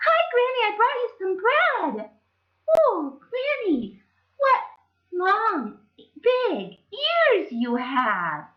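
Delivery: put-on high, slow child voice; drawn-out wonder at granny's ears; wondering Little Red.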